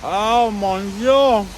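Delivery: slow, loud, high French chef exclaim; drawn-out mock French delight. Mock French exclamation over sandwich.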